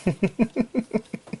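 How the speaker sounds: high, quick chuckle; self-amused giggle in a short run; laugh. Real, not acted. Reviewer amused by his own failed accent, chuckling.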